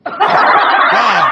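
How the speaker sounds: laughing hard, words breaking up; helpless laughter at his parents; real laughter. Real, not acted. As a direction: crack up mid-line. Cracking up at parents.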